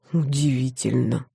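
low, soft murmur; muttered. A narrator's reading. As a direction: mutter it to yourself, quietly. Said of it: "пробормотал он".

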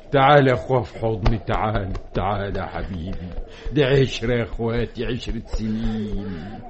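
low, softening, emotional voice; tender farewell. Acted. Emotional farewell to old companion. (بحنان وتأثر وصوت واطي)

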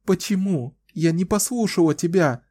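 high, tearful, catching sobs; sobbing. A narrator's reading. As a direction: sob the question out. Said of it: "всхлипывала она".

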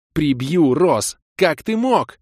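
high, loud scolding as Kira; angry girlfriend. Acted. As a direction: let her blow up at him. Kira scolding her boyfriend.

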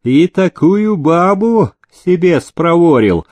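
high, drawn-out jeering character voice; slow, mocking exclamation; smirking jeer. A narrator's reading. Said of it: "ухмыльнулся Прокофий".

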